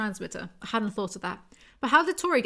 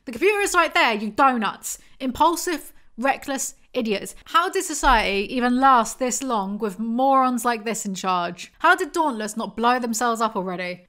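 fed-up loud rant; exasperated rant; 4 dB louder than usual. Real, not acted. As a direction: scold the characters loudly. Ranting at characters.

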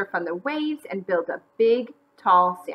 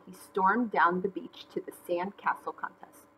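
ordinary delivery

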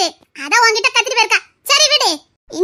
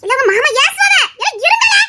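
calling out; 6 semitones higher than usual. Acted. Aunt calls after leaving boys.

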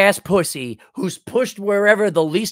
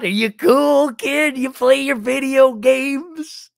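much higher, slow patronising sneer; mocking; 8 semitones higher, slower than usual. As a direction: talk down like to a child. Mocking the lazy student.

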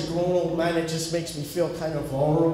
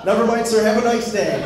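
louder, higher, briskly polite goodbye; curt fake-polite brush-off; 6 semitones higher, 7 dB louder than usual. Leaving the fan's doorstep, curt loud fake-polite goodbye. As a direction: slam the door politely. Abrupt exit from door.

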